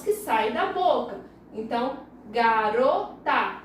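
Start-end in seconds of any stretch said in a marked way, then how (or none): 2.3-3.6 "garota" drawn apart as ga-ro-ta; "garota" in measured beats, ga, ro, ta; syllable by syllable. Shown as a demonstration. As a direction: say "garota" slowly in three distinct syllables. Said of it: "São três sílabas".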